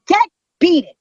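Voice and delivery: high, sudden shooing; sharp dismissal. Retold or imitated. Telling Jerry to get away from the pictures, sharp dismissal. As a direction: send him off with a high burst.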